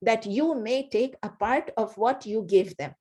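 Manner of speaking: slow, deliberate restatement; teacher repeating the meaning, slowly; slow repetition. Shown as a demonstration. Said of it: "Again I say".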